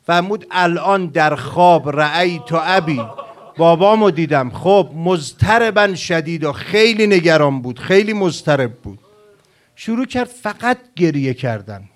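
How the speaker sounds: high, loud lamenting sermon; impassioned preaching. Acted. Preacher recounting the girl's nightmare, impassioned and loud. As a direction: preach it at full force, climbing.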